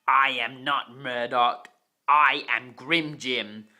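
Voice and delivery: true villain voice. Acted. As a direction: declare who you really are, slowly and loud. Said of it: "turned back into his normal self".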